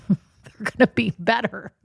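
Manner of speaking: talking through real laughter; helpless giggle under the whole line; laughing. Real, not acted. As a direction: let the laugh break up the words. Laughs at betting on a spouse.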